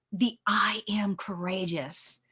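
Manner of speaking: slow, soft whisper of the words; whispered affirmation. Shown as a demonstration. Host whispering a good affirmation into her listeners, slow. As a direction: hush it like a secret to yourself.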